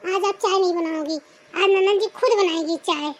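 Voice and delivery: ordinary talk, nothing marked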